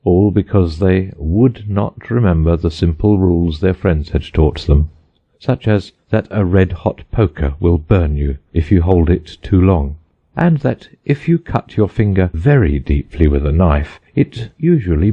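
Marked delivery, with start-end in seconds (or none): none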